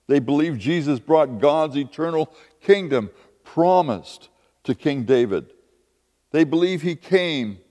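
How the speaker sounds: normal talking voice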